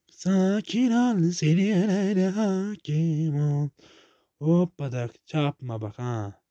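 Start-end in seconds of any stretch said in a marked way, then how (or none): 0.1-3.7 slow, drawn-out mock soothing; slowly reciting a calm-down mantra to himself. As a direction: stretch each word like a calming guru. Calming mantra repeated.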